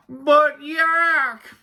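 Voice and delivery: high, loud, drawn-out yell; yelling Walrus. A narrator's reading. Walrus trying to interrupt Yak, yelling. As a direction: yell the name in exasperation. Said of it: "yelled Walrus".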